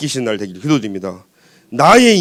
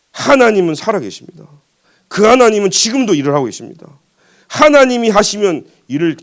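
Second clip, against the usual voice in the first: higher-pitched forceful proclamation; emphatic preaching; 5 semitones higher, 4 dB louder than usual. Real, not acted. Preacher telling young remnants God is alive, emphatic. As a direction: hit each opening hard, like a preacher.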